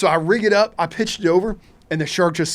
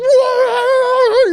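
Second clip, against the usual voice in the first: long high stretched whoa; drawn-out high cry of amazement; excited whoa; 7 dB louder than usual. Retold or imitated. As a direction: let it soar up as the reel spins. Reel going crazy.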